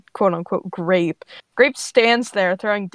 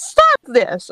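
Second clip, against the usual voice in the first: loud, sharp 'stop'; raised-voice villain command; angry growled command; 4 semitones higher, 8 dB louder than usual. A narrator's reading. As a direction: order them to stop at once. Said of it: "growls and then puts her hand down".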